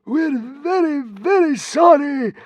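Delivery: high, fearful consul voice; stammering. A narrator's reading. The fat consul stammering apologies to the god. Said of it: "stammered the fat consul".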